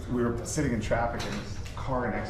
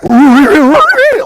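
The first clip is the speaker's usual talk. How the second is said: much higher and louder, a shrieking Really; enthusiastic Scooby-Doo Really; 20 dB louder than usual. Retold or imitated. Mocking the Japanese interviewer, peak Scooby-Doo squeal. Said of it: "a really enthusiastic Scooby-Doo".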